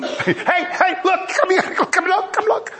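high-pitched, urgent beckoning; excited calling out. Acted. Calling somebody over to show an amazing sight, excited and high. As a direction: call someone over to see something amazing. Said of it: "had to call somebody to show it".

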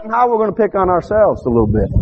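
high, rising revival preaching; preaching at full force. Acted. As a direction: preach it out to the pews. Preacher builds the parable.

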